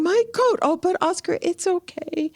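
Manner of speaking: a tearful wail that turns to comfort; imitating crying. Ella crying over her coat yet comforting Oscar, high and tearful. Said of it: "she's crying, saying".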